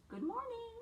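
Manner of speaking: put-on a child's sunny good morning; cheerful little boy. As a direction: pop up high like a small boy saying hello. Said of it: "And he says".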